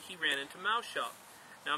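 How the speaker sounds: plain reading voice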